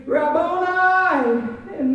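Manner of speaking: high, drawn-out cry of recognition; imitated joyful scream; retold scream. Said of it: "and she screamed".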